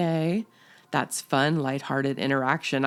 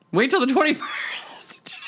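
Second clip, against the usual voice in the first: higher conspiratorial whisper; 9 semitones higher than usual. Pretend. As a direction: whisper it like a secret. Conspiratorial advice.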